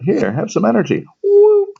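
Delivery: high, bright playful squeal; excited whoop. Shown as a demonstration. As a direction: squeal it like a cartoon. Playful electron jump demo.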